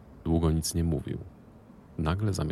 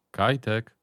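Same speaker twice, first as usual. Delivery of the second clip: loud call down the corridor, pitched up and falling; 7 semitones higher, 5 dB louder than usual. Acted. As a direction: call the boy's name loud and high. Calling son from the room. (głośne wołanie imienia)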